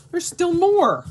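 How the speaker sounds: high and loud with glee; excited. Real, not acted. Digging further into the package, loud high excitement. Finds more items in package.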